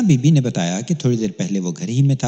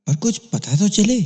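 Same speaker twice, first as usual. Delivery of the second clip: higher, worried urging; a hint of a fretful father; worried plea; 10 semitones higher than usual. A narrator's reading. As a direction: press for news, anxious and pitched up. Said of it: "فکر مندی سے مجھے دیکھتے ہوئے کہا".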